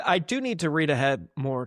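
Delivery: ordinary talk, nothing marked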